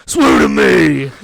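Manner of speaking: loud slow gravelly shout. Retold or imitated. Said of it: "the [name] voice".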